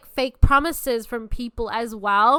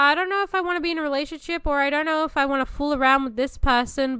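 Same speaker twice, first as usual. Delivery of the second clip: mocking indecisive whine; 5 semitones higher, faster than usual. Twenties guy unsure what he wants, mocking indecisive whine. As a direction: imitate a waffling guy, pitched up.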